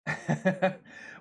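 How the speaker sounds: real, easy chuckle; amused chuckle. Real, not acted. Streamer amused before telling the birth story, chuckling.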